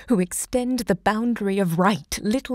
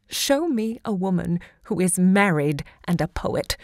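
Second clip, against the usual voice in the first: ordinary talk, nothing marked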